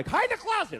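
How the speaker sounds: put-on high, loud little-girl order; bossy little girl. Daughter ordering Papa where to hide, high child voice. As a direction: boss him like a small child. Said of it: "She goes".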